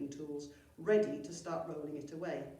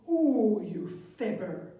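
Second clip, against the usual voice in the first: loud, indignant goblin splutter; outraged squawk; 4 semitones higher, 11 dB louder, slower than usual. Acted. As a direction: spit it out at your lying brother. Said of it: "spluttered Seepage".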